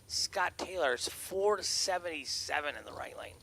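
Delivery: brisk play-by-play call over the PA; race announcer. Acted. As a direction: call the drag race live, punchy. Live drag race calling.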